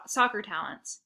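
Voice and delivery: usual speaking voice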